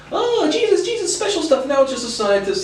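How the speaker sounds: very high mock-reverent squeal sliding down; exaggerated falsetto send-up. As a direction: go absurdly high, then slide back toward normal. Mocking retold pitch to the evangelical.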